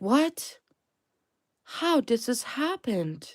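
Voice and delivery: high-pitched mock bewilderment, drawn-out question; angry disbelief. Reader mimics the angry enemies, high slow disbelief. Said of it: "Angry. They're like".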